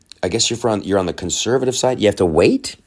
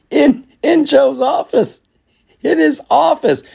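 high squeaky laughing voice; can't hold back the laugh; squeaking with laughter; 5 dB louder than usual. Real, not acted. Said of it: "I don't know why I keep laughing".

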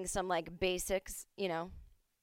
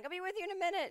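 much higher stressed brush-off; stressed bartender; 12 semitones higher, much faster than usual. Retold or imitated. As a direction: chirp I'll be with you in a minute in a frazzled, high voice. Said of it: "she just keeps being like".

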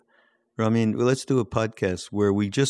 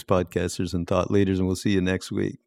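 ordinary delivery

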